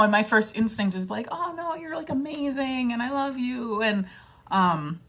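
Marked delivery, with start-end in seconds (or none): 1.3-3.8 high, soft gushing reassurance; reassuring. Retold or imitated. Reassuring a self-critical friend, softly gushing. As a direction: comfort them sweetly, pitched up.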